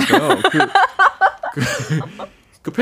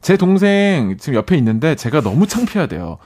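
normal talking voice

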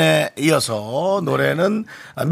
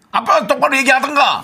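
raised-pitch mock reprimand; acted scolding; 11 semitones higher than usual. Host voicing a listener's rebuke to a backbiter, mock anger. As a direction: tell them off indignantly.

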